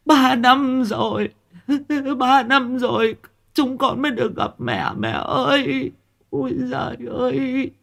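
very high, drawn-out wailing for a mother; keen and sob in a high, slow voice; sobbing wail. A narrator's reading. Daughters weep over their mother's exhumed body, wailing sobs. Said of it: "khóc ngằn ngặt".

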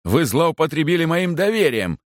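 high-pitched protest, no real shouting; indignant cry. A narrator's reading. Stubborn guest reproaches the chaplain, indignant cry. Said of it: "закричал упрямый гость".

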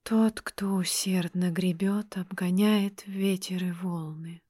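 soft, slow, distant reply; quiet answer. A narrator's reading. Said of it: "раздался тихий ответ".

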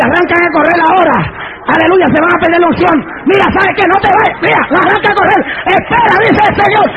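fast, high-pitched revival preaching; full-force preaching. Acted. Preacher urging the church to wait and see, full-force preaching. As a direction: preach it urgently at the top of your voice.

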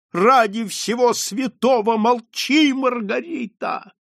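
high, slow anguished plea; desperate pleading. A narrator's reading. Marquise begging her daughter in despair. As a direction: beg her to stop. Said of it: "в отчаянии ломая себе руки".

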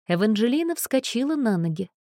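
ordinary delivery

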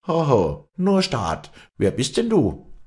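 loud, high, insolent challenge; cheeky maid. Acted. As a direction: sneer at the newcomer cheekily. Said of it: "Die Magd sah Walli frech an". (freche Magd, laut und spöttisch)

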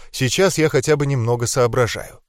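ordinary delivery, nothing marked

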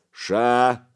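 short, loud, growled halt; roared order. A narrator's reading. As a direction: roar it to stop a brawl. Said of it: "рыкнул Гораст".

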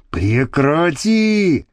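slow, drawn-out objection; pleading protest. A narrator's reading. Man stopping his lover's hand, protesting. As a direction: stretch out the protest.

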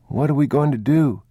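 put-on high child's question; anxious little boy. A narrator's reading. Boy out of food asking father, high anxious question. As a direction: ask helplessly in a child's pitch.

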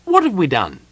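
high, loud, puzzled kender; baffled question. A narrator's reading. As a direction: ask in bewildered excitement. Kender puzzled by gnome's triumph.

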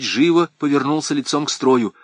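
ordinary delivery, nothing marked

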